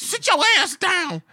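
high, loud and slow; mock order. Girl telling the guy to sit down, high loud command. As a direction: bark it like a tough woman, slowly.

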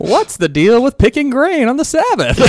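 high, drawn-out comic bit voice, mock stand-up; Jerry Seinfeld. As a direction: hit it like a stand-up asking the crowd. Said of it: "my best Jerry Seinfeld impersonation".

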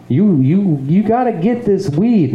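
high-pitched insistent hustler; pushy seller. Retold or imitated. Voicing the pushy weed seller.

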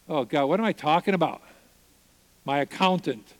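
normal talking voice

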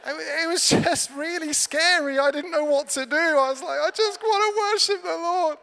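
high, loud comic panic; mock-scared wail. Scared newcomer who just wants to worship, mock-panicked. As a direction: squeal it like an overwhelmed newcomer.

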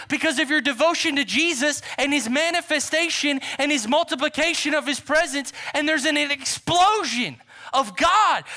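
high, loud, driving revival preacher; full-force preaching. Acted. Preacher picturing revival spreading to his church, preaching at full force.

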